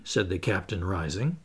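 usual speaking voice

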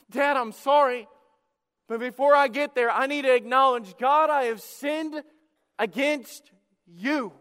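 pleading confession. Acted. Repentant son voiced in plea.

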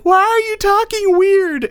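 put-on very high, loud, slow kid wail; child freaking out. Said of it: "she freaks out".

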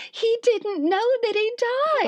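high-pitched, laughing through the words. Real, not acted. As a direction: squeal it out in laughing disbelief. Laughing disbelief retelling husband.

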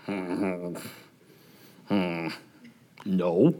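low, soft hums, spaced out; sheepish murmur. Retold or imitated. Counselors answering the director's question, low sheepish murmur. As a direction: imitate a chastened group mumbling its answer. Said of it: "we were all like".

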